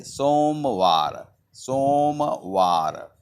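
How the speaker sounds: slow, stretched "Somvar"; pronunciation demo. Shown as a demonstration. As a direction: say "Somvar" in two long, separate syllables. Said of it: "the pronunciation in Hindi".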